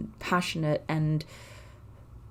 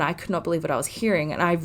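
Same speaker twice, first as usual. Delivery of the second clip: usual speaking voice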